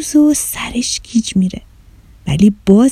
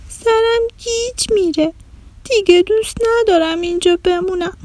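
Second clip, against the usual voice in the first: put-on whiny sick child; 8 semitones higher than usual. Sick girl complaining to her friend about pains, whining. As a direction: whimper through every ache.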